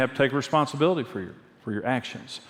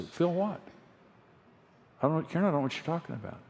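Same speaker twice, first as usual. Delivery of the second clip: evasive brush-off; 5 semitones higher, 7 dB quieter, faster than usual. Retold or imitated. His evasive reply to Karen.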